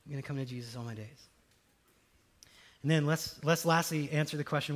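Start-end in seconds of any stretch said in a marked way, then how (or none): 0.0-1.3 much softer and quicker, a little deflated; sheepish repeat. Shown as a demonstration. Pastor repeats his underwhelming answer, softly and sheepishly. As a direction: mumble it like an anticlimax. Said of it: "kind of not the answer I was expecting".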